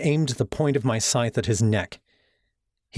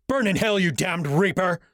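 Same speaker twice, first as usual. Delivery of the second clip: screamed curse; 9 semitones higher than usual. Acted. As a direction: hurl the curse at him. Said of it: "he screamed".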